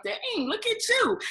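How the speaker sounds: hammed-up impressed admirer; admiring guest.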